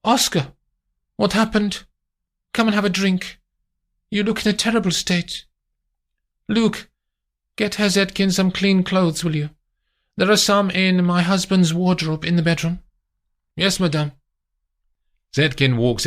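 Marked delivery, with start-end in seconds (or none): 0.0-12.8 high, concerned lady giving orders; high voice, worried then brisk; Amandine. Acted. Amandine voiced in a long run. 13.5-14.1 lightly raised, obedient reply; just accept the order; mild dutiful servant; a hint of the servant. Chauffeur obeying.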